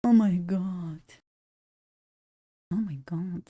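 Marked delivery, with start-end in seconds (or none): none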